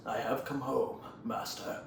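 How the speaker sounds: put-on quiet villain confronting his old master; Tai Lung. Villain returns to face his old master, quietly menacing. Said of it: "Tylon goes".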